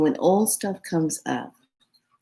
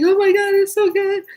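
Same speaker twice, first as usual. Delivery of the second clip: much higher, louder gasp of delight; ecstatic squeal; 5 dB louder than usual. Retold or imitated. Telling friends about the spicy pasta, high delighted squeal. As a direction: squeal over how good the food tastes. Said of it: "I'm like".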